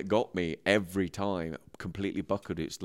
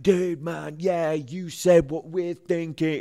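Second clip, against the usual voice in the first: bro fan voice; 10 semitones higher, 7 dB louder, slower than usual. Retold or imitated. Quoting the homophobic fan.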